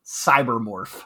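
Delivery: slow low dramatic title read; low drawn-out announcer name; deadpan meme voice. Acted.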